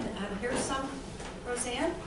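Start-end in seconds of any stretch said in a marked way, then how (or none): none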